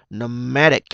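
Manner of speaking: Nomadic with MA punched loud; stress before -ic. Shown as a demonstration. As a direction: say Nomadic as no-MA-dic. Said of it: "nhấn trước đó ha". (nhấn âm hai Nomadic)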